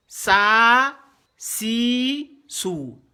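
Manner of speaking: "Sa", "si", "sou" each drawn out; sustained syllables, "Sa" loud, "sou" falling away; long vowels. Shown as a demonstration. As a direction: stretch every vowel in "Sa, si, sou". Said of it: "Si on veut tirer".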